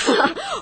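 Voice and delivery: risada aguda e leve. Acted. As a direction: ri descartando a ideia. Ri das suspeitas de Lúcia. (Ana ri das suspeitas de Lúcia)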